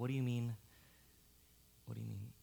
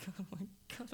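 normal talking voice

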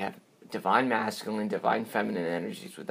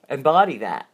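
embody said higher and louder; stressed embody; 5 semitones higher, 8 dB louder than usual. Shown as a demonstration. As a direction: lean on embody to make the point. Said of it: "what we need to do is".

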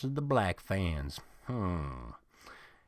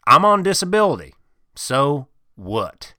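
sudden higher, louder, fast blurt; defensive outburst; 5 semitones higher, 8 dB louder than usual. Acted.

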